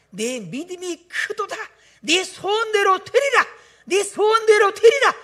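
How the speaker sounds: building proclamation. Acted. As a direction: swell each repeat into a triumphant cry. Preacher proclaims Jesus' words.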